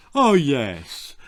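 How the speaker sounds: a dull, even-toned "Oh, yes" from a dim husband; lightly voiced half-witted husband, monotone. A narrator's reading. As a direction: say it all on one note, half-witted. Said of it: "monotoned her half-witted husband".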